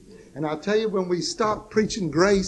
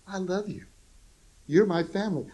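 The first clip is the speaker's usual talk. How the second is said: ordinary talk, nothing marked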